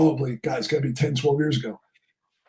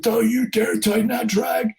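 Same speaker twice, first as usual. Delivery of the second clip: high mock yell, no real volume; retold scream; 6 semitones higher than usual. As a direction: imitate an irate father screaming orders. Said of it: "the father screamed".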